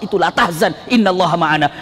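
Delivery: ordinary talk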